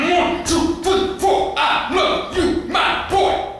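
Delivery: very high, loud, word-by-word cries; violent shouted count. Acted. Daddy whips his boy saying he loves him, shouting. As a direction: punch each word like a blow. Father counts blows, declaring love.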